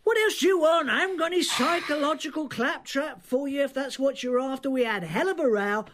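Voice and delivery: high-pitched, defensive irritation; exasperated retort. Acted. Matt rejects Lillian's probing questions, exasperated. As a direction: push back, voice pitched well up. Snaps back when pressed.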